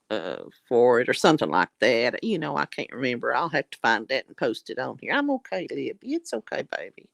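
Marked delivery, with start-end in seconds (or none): 5.1-7.1 much higher, soft cooing to the dog; soothing pet voice. Real, not acted. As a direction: reassure the little dog sweetly, softer and softer. Soothing her dog licking arm.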